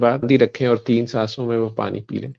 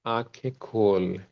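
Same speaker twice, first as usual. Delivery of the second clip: very soft, slow release from meditation; soft slow cue; 10 dB quieter, much slower than usual. Shown as a demonstration. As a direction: gently bring them back, barely above a breath. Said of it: "जब मैं कहूंगा तो आंखें खोलिएगा".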